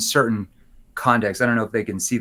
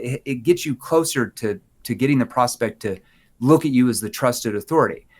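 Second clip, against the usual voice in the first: ordinary delivery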